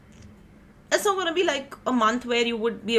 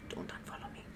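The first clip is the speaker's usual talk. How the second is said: breathless mock whisper, much softer; joking whisper; 18 dB quieter than usual. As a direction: whisper it like a playful secret plea. Joking aside to viewers.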